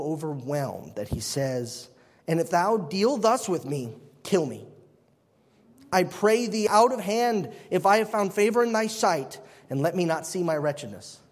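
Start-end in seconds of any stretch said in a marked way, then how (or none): 2.3-11.2 solemn King James reading; formal Bible reading voice; scripture reading. Acted. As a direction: read the verse with weight. Reads scripture aloud.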